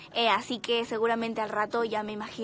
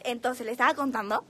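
ordinary talk

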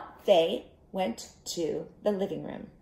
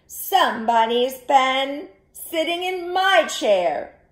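raised, loud, drawn-out mama bear; medium-sized mama bear voice; 7 semitones higher, 10 dB louder, slower than usual. A narrator's reading. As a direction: call it out slowly as mama bear, higher. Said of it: "she said in her medium-sized voice".

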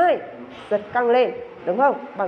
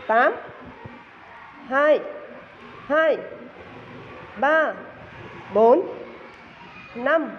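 counting workout reps; 2 semitones higher, much slower than usual. Shown as a demonstration. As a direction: call each beat like a fitness coach. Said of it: "kết hợp đếm nhịp".

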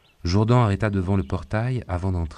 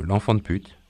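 playful quote; 2 semitones higher than usual. Acted. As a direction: insult handed back lightly. Bobby playfully repeats the insult. (reprise légère du juron, en plaisantant)

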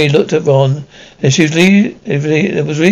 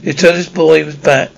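usual speaking voice

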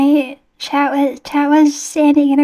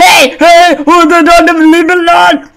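panicked begging, loud; scared pleading; 3 semitones higher, 8 dB louder than usual. Real, not acted. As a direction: fend it off in fright. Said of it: "Your voice dropped, like, five octaves".

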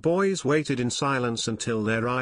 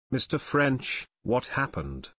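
ordinary delivery, nothing marked

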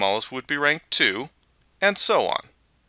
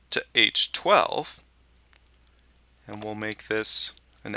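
slow, piece by piece with pauses; dictating. Shown as a demonstration. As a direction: read each part of the formula out clearly. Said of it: "enter the following formula".

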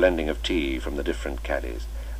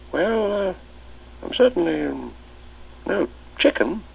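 put-on twinkling old master; 14 semitones higher than usual. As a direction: cap their joke with a merry invitation to tea. Chips joking about age.

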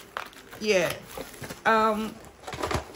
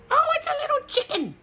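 much higher, quick squeal of surprise; real surprised squeal; delighted squeal; faster than usual. Real, not acted. Delighted discovery in kit.

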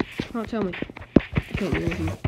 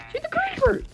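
frustrated yell. Real, not acted. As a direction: yell the order at him. Yelling orders at a skeleton.

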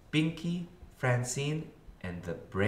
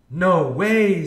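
high, very loud disbelief; amazed exclamation; 8 semitones higher, 12 dB louder than usual. A narrator's reading. Francine hearing her favorite named, amazed exclamation. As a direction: burst out in delighted disbelief.

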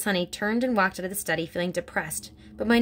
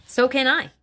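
higher and louder sharp snap; snapped; 6 semitones higher, 7 dB louder than usual. Acted. Headmistress cutting off Miss Honey, loud snap. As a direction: snap it back at her. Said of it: "Miss Trunchbull snapped".

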